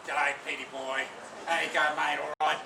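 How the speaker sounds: loud, hearty put-on Australian accent; best Aussie accent. Speaker imagines greeting retiring Peter, mock Aussie accent. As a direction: do a jokey Aussie greeting, projected. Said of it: "in my best Aussie accent".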